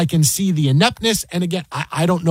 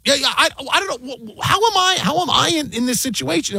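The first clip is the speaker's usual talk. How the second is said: mock whiny, flustered boss, pitched much higher; hammed-up stammering boss caught out; flustered manager; 8 semitones higher than usual. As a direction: play the panicking manager squeaking out excuses. Mocking flustered manager's excuse.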